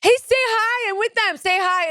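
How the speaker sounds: high, bright phone chatter; high caller voice on a phone call; phone friend voice. Retold or imitated. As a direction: play the friend shoving the phone at you. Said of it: "they go".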